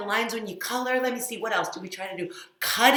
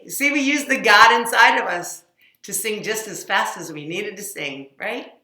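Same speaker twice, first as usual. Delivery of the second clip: usual speaking voice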